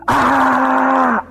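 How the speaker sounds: high-pitched drawn-out "aaah" scream; high yell. Retold or imitated. Seeing the Three Stooges in a dream, high yell. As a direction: let out a long, high cry. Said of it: "I remember going".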